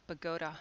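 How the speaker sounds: Bogota said the New Jersey way, buh-GO-tuh; local pronunciation. Shown as a demonstration. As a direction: stress the middle of Bogota, long O. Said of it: "but they pronounce it Bogota".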